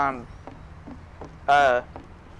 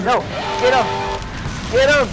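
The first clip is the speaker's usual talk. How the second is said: shouting; 6 semitones higher, 5 dB louder than usual. Real, not acted. Urging teammate in fight.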